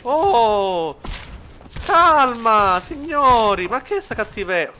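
high, very loud yelling at the swarm; panicked shout. Real, not acted. Gamer overwhelmed by enemies, yelling high and loud. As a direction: shout them down in alarm.

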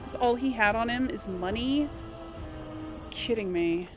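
ordinary delivery

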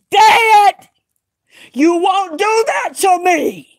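high, loud and slow, a cry breaking into a shout. Retold or imitated. Retelling her prayer, the final anguished cry. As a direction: cry it out against death. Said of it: "I said".

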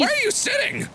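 high, startled question; sudden high-pitched alarm. Real, not acted. Alarmed at friend sitting.

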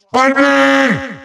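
held, high-pitched villainous cry; triumphant shout. Acted. Villain warns hero who will be watched, triumphant shout. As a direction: shout it as a grand entrance.

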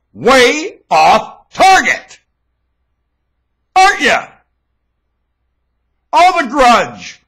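loud, very slow, each phrase hammered down; accusing refrain. Real, not acted. Speaker scolding the listeners for their grudges, loud slow accusing refrain. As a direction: drive the refrain home like a preacher.

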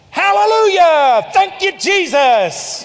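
loud, high, drawn-out praise holler; joking praise shout. Retold or imitated. Preacher retelling his basketball outburst to the congregation, joking praise shout. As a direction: holler slow, exuberant praise, well above your talking pitch. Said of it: "I was kind of joking around".

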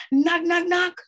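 high, loud, even raps; insistent knocking. A narrator's reading. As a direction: rap the words out like a fist on wood. Narrator imitates firm knocking.

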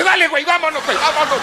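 high panicky mock wife voice; wife's voice. Wife begging husband to drive away, high panicky squeal. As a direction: squeal it, urging him to leave.

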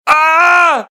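very loud, high "ah" bellow; shocked roar. Acted. Father walks in on mess.

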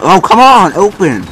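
ordinary talk

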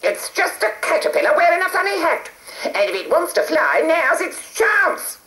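high screechy witch call; a nasty witch shouting hey. A narrator's reading. Witch Edna sneers.

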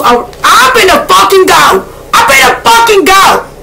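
excited shout. Real, not acted. Shouting at the cards to Virgo viewers, wildly excited. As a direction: yell it out, fully hyped.

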